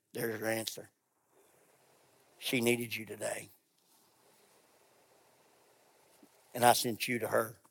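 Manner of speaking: a low, hushed divine voice; hushed voice of the Spirit. Retold or imitated. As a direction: speak it like a sacred secret. Voicing God's Spirit in story.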